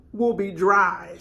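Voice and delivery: normal talking voice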